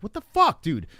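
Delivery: loud, high-pitched disbelieving protest; exasperated outburst. Retold or imitated. Parent reacting to the helicopter story, loud exasperation. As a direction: burst out, appalled at what you just heard.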